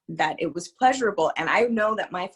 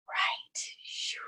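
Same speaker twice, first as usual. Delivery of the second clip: whispering; 9 dB quieter than usual. Real, not acted. Realizing she forgot to call.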